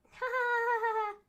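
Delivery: high, sing-song "ha ha ha"; imitating a spooky giggle; mock creepy laugh. Host mimics Alexa's eerie laugh, high and sing-song. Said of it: "Alexa came out and was like".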